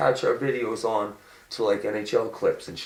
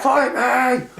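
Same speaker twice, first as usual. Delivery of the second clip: high, loud goading yell; mock shout; 13 semitones higher, 4 dB louder than usual. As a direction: yell it to egg on the fight. Repeats Emery's fight cry.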